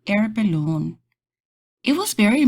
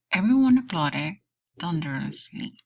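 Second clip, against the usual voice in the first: plain reading voice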